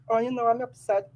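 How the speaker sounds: acted downplaying. Acting someone downplaying their anger.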